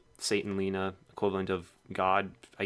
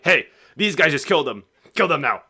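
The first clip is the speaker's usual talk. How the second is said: loud, higher-pitched alarmed announcement; news reporter; 5 semitones higher, 6 dB louder than usual. Acted. Reporter announces on the news who killed someone, loud urgent call. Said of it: "he'll be like".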